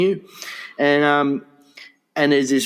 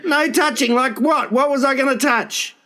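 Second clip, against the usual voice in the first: higher, outraged what was I gonna touch; indignant disbelief; 8 semitones higher than usual. Real, not acted. Objecting to the lady's no-touching rule, indignant. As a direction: protest the ridiculous rule.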